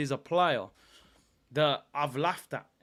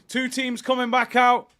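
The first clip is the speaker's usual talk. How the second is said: loud announcement; 9 semitones higher, 9 dB louder than usual. Real, not acted. Announcing teams returning, loud.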